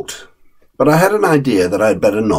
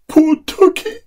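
put-on high, weepy small boy's voice; a delicate rich boy near tears; tearful little boy. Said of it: "He was crying".

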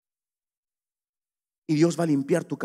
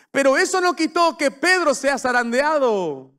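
higher and louder, slowing on the promise; preaching at full force; 8 semitones higher, 5 dB louder than usual. Acted. Preacher assuring the church their faith holds, full force. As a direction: build it up to the crowd.

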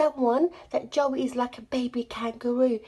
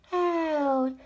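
ordinary talk, nothing marked

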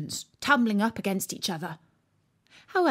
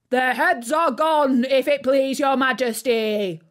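louder, higher shouted reply; soldiers shouting; 4 semitones higher, 6 dB louder than usual. A narrator's reading. Soldiers shouting their reply to the queen. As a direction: call back to the queen. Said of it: "the soldiers shouted in reply".

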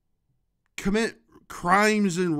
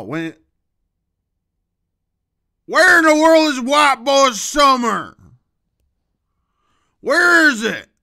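loud, punchy comic ranting; hammed-up loud riff; 3 semitones higher, 7 dB louder than usual. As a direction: keep yelling the bit at the camera.

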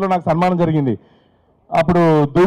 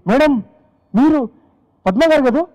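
eager attendant; 7 semitones higher than usual. Retold or imitated. Petrol attendant recognizing her.

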